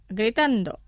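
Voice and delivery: ordinary talk, nothing marked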